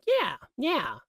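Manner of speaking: high, airy goofball voice; chirpy sitcom oddball; Chit's catchphrase. Retold or imitated. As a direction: squeak it out like a clueless office guy. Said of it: "He says things like".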